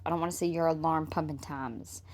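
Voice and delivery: normal talking voice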